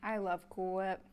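usual speaking voice

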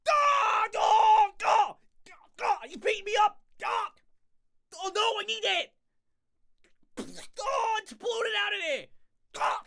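high, loud mock cries of pain; hammed-up wailing. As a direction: howl in over-the-top agony.

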